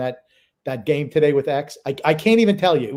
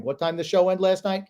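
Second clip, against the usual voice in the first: ordinary talk, nothing marked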